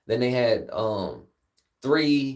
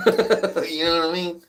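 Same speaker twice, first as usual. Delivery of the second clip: laughing; 5 semitones higher, slower than usual. Real, not acted. Laughs at his own joke.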